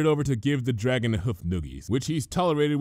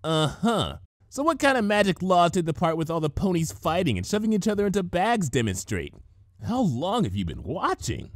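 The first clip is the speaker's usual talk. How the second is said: lightly voiced teasing tomboy; Rainbow Dash voice; 7 semitones higher than usual. A narrator's reading. Rainbow Dash calling out Twilight's story, lightly voiced. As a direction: poke fun at the cover story.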